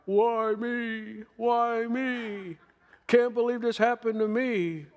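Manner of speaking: high, whining mock wail of why me; whiny self-pity. Believer mocking the self-pitying cry, high mock whine. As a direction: whine it like a sulking victim, pitched high. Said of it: "what I'm not doing is crying".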